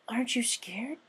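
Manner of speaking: high, breathy whisper; frightened filly whispering; whispered question. Acted. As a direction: whisper it, afraid of the answer. Scootaloo asks softly.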